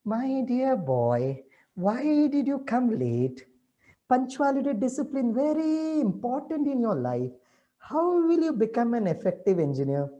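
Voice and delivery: slow, gentle loving teacher voice; with lots of love. Retold or imitated. Teacher gently asking a late student why, loving. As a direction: ease the question out tenderly. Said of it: "with lots of love I tell these days".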